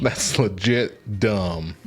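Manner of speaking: slow, stretched-out put-down; slow drawl. Real, not acted. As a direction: drag each word out. Drawn-out put-down.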